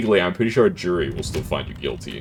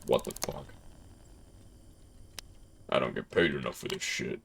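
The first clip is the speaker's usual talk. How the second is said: low, soft, fed-up judge voice; lower, quieter judge in a bad accent; terrible-accented judge; 6 semitones lower, 12 dB quieter than usual. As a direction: play a weary judge, low and under the breath. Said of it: "I don't know why the judge sounded like that".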